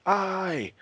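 high cheering shout of the crowd. Retold or imitated. Crowd roars agreement to Yara, high shout.

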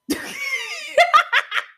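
shrieking laugh, way up high; high burst of laughter. Real, not acted. Podcaster laughing at her own fan reaction, high laughter. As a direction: laugh out loud at yourself, high and bright.

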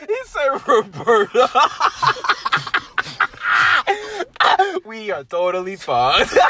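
high, loud, laughing through the words; helpless laughing. Real, not acted. Friend repeating the Roberta joke, helpless laughing. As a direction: barely get it out between big laughs.

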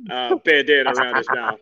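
usual speaking voice

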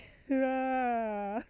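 loud, high mock wail; hammed-up crying wail; imitated bitter cry. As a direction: let out one long, drawn-out sob. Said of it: "you know that bitter cry".

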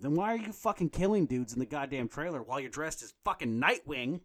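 ordinary talk

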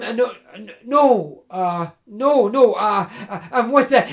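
nervous, halting, tripping on every no; flustered stammer. Acted. Jim nervously denying to the woodsman, stammering. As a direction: fumble for an excuse.

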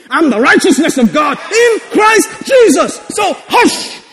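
high, forceful declaration building to a shout; preacher's shouted rebuke. Acted. Believer rebuking the world, high shouted rebuke. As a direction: silence them with authority.

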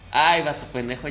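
ordinary talk

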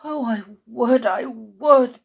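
eager bark. A narrator's reading. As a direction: bark it out like a keen dog. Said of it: "barked the dog".

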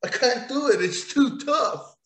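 hammed-up weakling; mock weak whine. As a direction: play a feeble guy who can't pull the sword.

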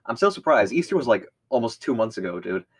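ordinary talk, nothing marked

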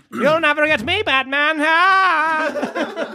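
high, gloating cartoon villain; silky polite villain. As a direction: taunt the hero with a mustache-twirling sneer. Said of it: "Your voice is so great".

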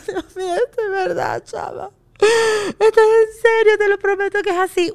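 high, half-laughing mock sob; mock crying. Podcaster retelling reading the article, mock crying. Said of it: "mi amor, con un pañuelo llorando".

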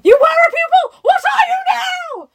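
hammed-up shouted interrogation; frantic questioning. A narrator's reading. Gentleman cuts into Sarah's account of herself, frantic shouted questions.

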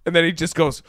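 ordinary talk, nothing marked

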